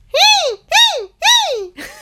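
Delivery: very high, short bird cries; imitated bird call. Shown as a demonstration. Voice teacher shows timbre through birds, high bird-call squeals. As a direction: squeal like a bird in piercing little bursts. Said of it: "algún ave que puede hacer".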